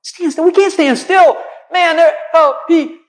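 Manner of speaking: shouting in disbelief; a frantic high yell; panicked shout. Retold or imitated. Moses shouting back in panic.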